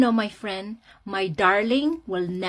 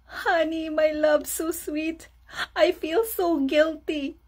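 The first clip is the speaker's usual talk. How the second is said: imitated tearful husband, pitched up; mock crying; 6 semitones higher than usual. Husband confessing guilt to his wife, mock tearful. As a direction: whimper out the guilt in hammed-up sobs. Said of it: "I saw him crying".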